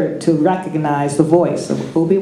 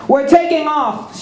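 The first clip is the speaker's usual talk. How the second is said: higher urgent call; a touch of a pilot's shout; mild shout; 9 semitones higher than usual. A narrator's reading. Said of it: "shouted Han".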